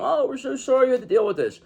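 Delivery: high-pitched gushing fake sorry; imitating fawning apologizers; mock apology. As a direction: apologize in a sing-song high voice. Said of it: "Now everybody's gonna come".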